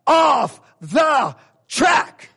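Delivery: slow, huge shouted warning; yell. Shown as a demonstration. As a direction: scream it at someone on the rails. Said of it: "You gotta yell".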